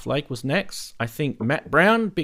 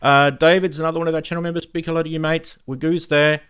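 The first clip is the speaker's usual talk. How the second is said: usual speaking voice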